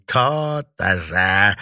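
put-on slow, gloating old witch; self-satisfied villainess. A narrator's reading. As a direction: savour the small victory, drawn out and emphatic. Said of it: "удовлетворённо кивнула старуха".

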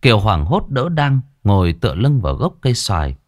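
plain reading voice

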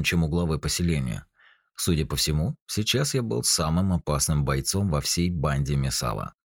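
usual speaking voice

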